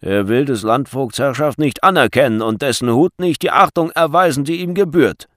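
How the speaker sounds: forceful, indignant denunciation; accusing guard. A narrator's reading. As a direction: proclaim the charge to a crowd. Guard accuses Tell before crowd.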